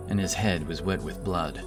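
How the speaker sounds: usual speaking voice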